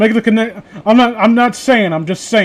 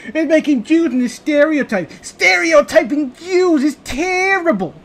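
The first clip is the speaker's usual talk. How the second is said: higher, slow mock-crying voice; South Park bit voice; 7 semitones higher than usual. Doing the South Park bit, mock crying in a high voice. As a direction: whimper it like a cartoon kid. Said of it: "do that bit from South Park".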